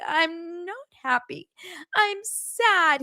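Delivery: high, slow, far-off pouting whine; mock sad whine. Imitating Meredith refusing wine, slow high pout. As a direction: pout it out, slowly. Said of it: "She's like".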